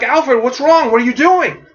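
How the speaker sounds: high-pitched, urgent confusion; confused alarm. Acted. Robins to Alfred aiming a rifle at them, confused alarm. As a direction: question Alfred in bewildered alarm. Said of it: "are all confused".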